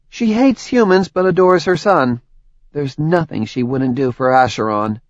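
friendly, chatty woman explaining; Tori's voice. Acted. Tori explains.

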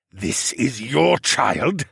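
very slow reptile-lord voice; incredulous lord. A narrator's reading. Regent lord asking about the boy, slow incredulity. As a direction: draw out the question in disbelief.

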